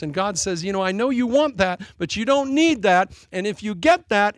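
loud, rising sermon delivery; fervent preaching. Acted. Pastor urging the congregation that God protects, preaching loudly. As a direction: press the point home to the pews.

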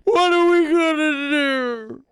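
high, loud squealing panic, slow, with laughter; mock panic. God pretending to panic over the coup, loud high squealed panic. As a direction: wail it like a helpless fusspot.

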